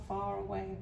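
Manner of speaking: usual speaking voice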